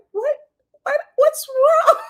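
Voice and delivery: way up high, loud, half-crying mock alarm; hammed-up high concern; shocked high squeal. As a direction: squeal it like you can't believe she's crying. Stormy reacts to the crying.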